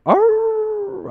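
high, loud imitated howl; dog howl. Storyteller imitating the dog heard down the road, howling. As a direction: howl like a hound. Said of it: "howling. Like".

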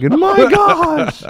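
high, loud hammed-up hero cry; mock heroic announcement. Hailing Pat rescuing the starving dog, high and hammed-up. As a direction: announce the rescue like a comic book. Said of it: "I was like".

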